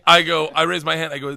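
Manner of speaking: normal talking voice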